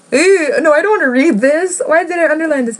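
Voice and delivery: high, squeamish recoil; real mortified squeal of refusal; grossed out. Real, not acted. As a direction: back away from the page in disgust. Recoiling from a cringy passage.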